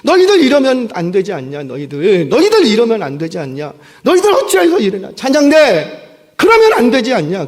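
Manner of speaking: acted dressing-down; reproaching. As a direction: tell them off forcefully. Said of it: "책망하고 있는 거예요".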